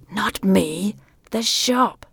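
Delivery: unvoiced whisper. A narrator's reading. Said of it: "the voice stopped whispering".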